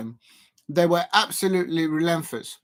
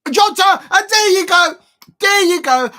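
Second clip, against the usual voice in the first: much higher, louder cry of the scorer; excited goal call; 13 semitones higher, 10 dB louder than usual. Real, not acted. Streamer calling Jota's goal live, in an excited shout.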